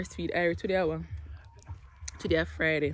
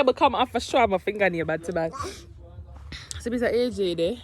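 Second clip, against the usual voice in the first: normal talking voice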